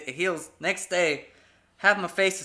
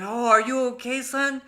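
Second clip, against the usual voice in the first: put-on higher-pitched fussing over a child; mock concerned parent; 7 semitones higher than usual. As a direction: play an over-caring parent, voice lifted. Imagined caring parent, instead of scolding.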